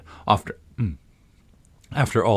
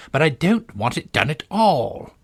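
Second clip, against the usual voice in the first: a touch of a groan, a little higher and plaintive; 6 semitones higher, 4 dB louder than usual. Acted. The helpless Queen complaining to Alice, with a mild groan. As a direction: moan it like a weary queen. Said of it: "groaned the poor Queen".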